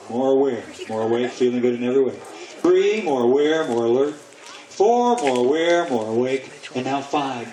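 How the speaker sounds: low, measured hypnotist voice, each number set apart; hypnotic count-up. Real, not acted. Hypnotist bringing volunteers awake on a count of five, calm hypnotic voice. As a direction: guide them gently up out of trance, low and steady.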